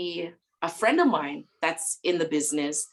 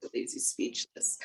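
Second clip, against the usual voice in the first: whisper; 8 dB quieter than usual. Real, not acted. Teasing echo, whispered.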